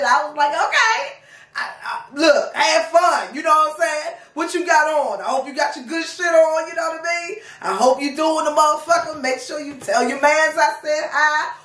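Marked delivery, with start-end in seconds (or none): 0.5-3.3 a sugary, high brush-off; mock sweet. Sarcastic reply to the cookout invite. 4.3-11.5 a sugary, high brush-off; mock sweet. Sarcastic well-wishes to the boyfriend.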